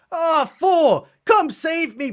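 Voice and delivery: high-pitched mock cry for help; imitated damsel plea. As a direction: play a hammed-up damsel in distress. Lady's plea for help voiced.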